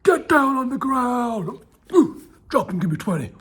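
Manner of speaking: shouted drill sergeant orders; barking gym coach. Acted. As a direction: bark commands, slow and loud.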